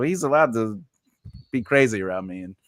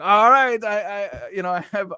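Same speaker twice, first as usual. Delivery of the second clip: imitating doctor friend; 7 semitones higher than usual. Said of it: "I almost did the accent".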